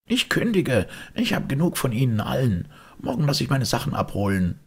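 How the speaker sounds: high-pitched huffy resignation; indignant outburst. Acted. Maid gives notice to everyone, indignant and high.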